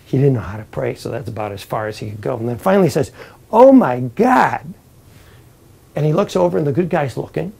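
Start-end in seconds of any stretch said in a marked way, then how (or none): 3.5-4.7 drawn-out plea. Acted. Bad guy pleading at cloud edge.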